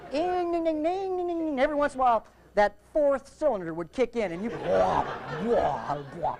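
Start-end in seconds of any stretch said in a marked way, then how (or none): 0.1-1.6 high, steady ringing drone; imitated engine whine. Fighting fire with fire, engine whining. 4.7-6.4 bursts of "wah" sinking lower; imitated engine surge. Fourth cylinder kicking in, engine surging. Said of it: "like that".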